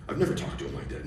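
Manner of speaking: ordinary talk, nothing marked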